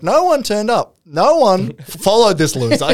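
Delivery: high, stressed mocking quote; stressed mockery. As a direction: lean hard on no one, mocking. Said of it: "he kept stressing".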